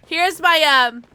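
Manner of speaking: ordinary talk, nothing marked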